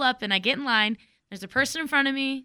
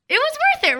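It was worth it, squealed much higher in a strange voice; squealed repeat; 3 dB louder, much faster than usual. Real, not acted. Echoing her own words to her friend in a high squeal. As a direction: squeal it back as a giddy echo.

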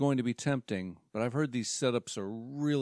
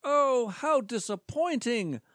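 mock disappointed; 10 semitones higher, 4 dB louder than usual. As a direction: overplay the letdown. Presenter disappointed.

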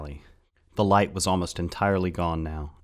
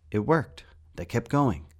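put-on higher boy's voice, relieved and a little louder; John, higher and louder; 6 semitones higher, 4 dB louder than usual. John telling Rat the monsters kept going, higher and relieved. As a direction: lift the voice up for John.

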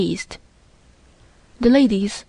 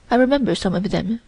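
usual speaking voice

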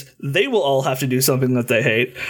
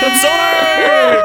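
slow, loud, drawn-out name call; announcer voice; 12 semitones higher, 7 dB louder, much slower than usual. Acted. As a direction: boom it out like a ring announcer. Grand drawn-out guest introduction.

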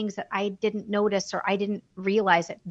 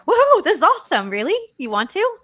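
high, loud excited gasp of joy; thrilled squeal, can't believe it; elated; 11 semitones higher, 7 dB louder than usual. Retold or imitated. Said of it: "And I was elated".

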